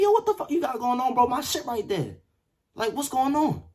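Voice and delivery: high-pitched, exasperated demand; retold angry protest. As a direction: protest to an unresponsive roommate. Demands answer from roommate.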